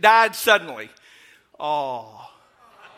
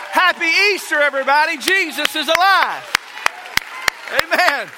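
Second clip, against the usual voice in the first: loud, high rallying preacher voice; preaching at full force; 6 semitones higher, 4 dB louder than usual. Acted. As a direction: fire the church up, shout it out. Preacher exhorts congregation at full force.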